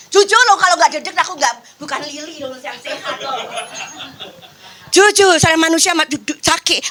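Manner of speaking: ordinary delivery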